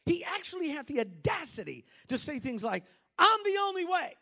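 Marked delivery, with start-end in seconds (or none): 3.2-4.2 loud, high declaration of authority; bold proclamation. Acted. Preacher voicing Jesus to congregation, loud and proud.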